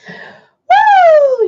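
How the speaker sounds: loud, high whoop of delight; excited whoop. Real, not acted. Streamer whooping at a chat comment, excited. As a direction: let out a big happy woo.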